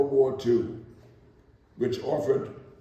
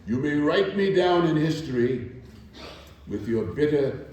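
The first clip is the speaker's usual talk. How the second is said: rising, declaiming poetry reading; spoken-word performance of Still I Rise; poem recitation; 3 semitones higher, slower than usual. Acted. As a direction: recite it with building defiance and pride. Recites Maya Angelou poem.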